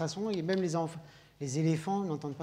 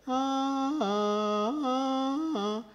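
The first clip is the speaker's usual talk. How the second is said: high register ahs; 7 semitones higher, much slower than usual. Shown as a demonstration. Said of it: "les deux cordes vocales plus hautes".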